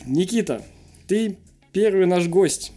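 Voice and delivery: normal talking voice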